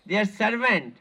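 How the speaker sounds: ordinary talk, nothing marked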